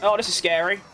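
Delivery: usual speaking voice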